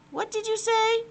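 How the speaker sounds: put-on high, puzzled young boy; a boy who really didn't hear. Albert asks again, puzzled.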